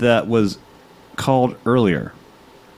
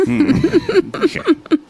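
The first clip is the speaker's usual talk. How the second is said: 1 Sling Blade grunt; much slower than usual. Pretend. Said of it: "give Ian Hawke a Sling Blade".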